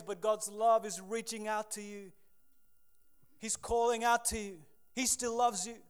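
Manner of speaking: loud, impassioned altar call; rising preaching. Acted. Preacher calling the fallen brother back to God's love. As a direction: plead with the brother to come back. Preaching at full force again.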